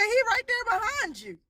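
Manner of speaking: put-on high, firm and insistent, a child sure of what she sees; dead serious child insisting. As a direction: say it flatly certain, pointing past her. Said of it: "Like, dead serious".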